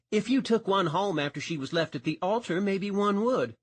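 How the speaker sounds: a hint of a sharp-tongued dispatcher ribbing a deputy; teasing older woman. Mildred ribs Sanchez about the jilted bride, light teasing.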